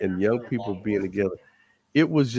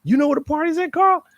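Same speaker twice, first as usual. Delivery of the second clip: put-on high, louder, giddy party kids asking; eager friends; 4 dB louder than usual. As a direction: climb up with excitement as they ask. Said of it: "Everybody's like".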